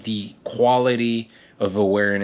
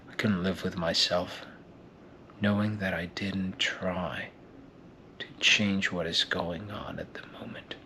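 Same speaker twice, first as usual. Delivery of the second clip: hushed, much softer, grave resolve; a hero's quiet vow to Louis; intense audition monologue; 7 dB quieter than usual. Acted. Acting out a film audition.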